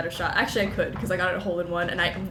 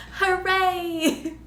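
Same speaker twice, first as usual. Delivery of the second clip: high laughing cheer; laughing hooray; 11 semitones higher, 5 dB louder than usual. Pretend. Streamer echoing the game's cheer, laughing hooray.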